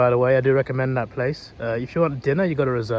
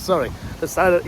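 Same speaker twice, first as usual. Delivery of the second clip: normal talking voice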